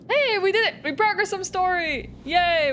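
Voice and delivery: excited. Real, not acted. Celebrating progress.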